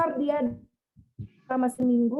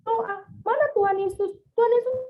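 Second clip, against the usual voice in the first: put-on delirious child calling; 8 semitones higher than usual. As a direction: imitate the feverish child crying out. Delirious child calls for Jesus.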